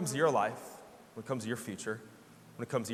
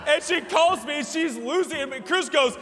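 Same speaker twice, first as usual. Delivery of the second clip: usual speaking voice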